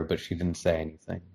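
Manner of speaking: ordinary delivery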